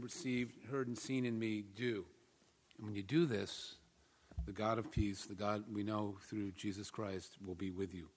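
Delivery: soft, measured reading aloud; scripture reading. Real, not acted. Pastor reading an epistle to the congregation, soft scripture reading. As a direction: read the passage quietly and reverently.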